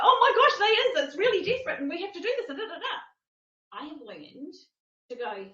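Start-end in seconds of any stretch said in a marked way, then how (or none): 0.0-2.9 high, loud flustered panic, laughing; mock panic. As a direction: squeal it like you're caught up in the frenzy. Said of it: "And it was like".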